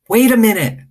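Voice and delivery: high, loud call across the store; hollered. Acted. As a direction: holler to stop the grown-ups. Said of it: "I hollered".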